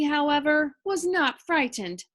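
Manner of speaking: ordinary delivery, nothing marked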